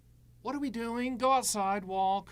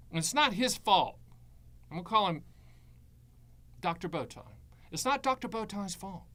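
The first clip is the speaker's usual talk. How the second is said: ordinary talk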